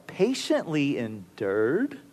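quiet disbelief. Retold or imitated. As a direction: mutter it to yourself in disbelief. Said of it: "I think to myself".

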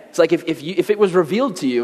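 ordinary talk

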